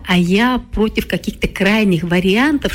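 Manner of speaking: ordinary talk, nothing marked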